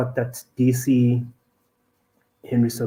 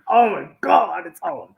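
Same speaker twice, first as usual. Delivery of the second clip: excited; 11 semitones higher, 4 dB louder than usual. Retold or imitated. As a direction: squeal it out like the fight's starting. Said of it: "you're like".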